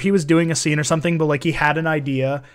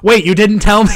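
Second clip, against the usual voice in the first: louder, higher mock double take; mock surprise; 5 semitones higher, 8 dB louder than usual. Friend replays the movie's twist line, mock surprised. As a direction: act the sudden realization. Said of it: "it's like".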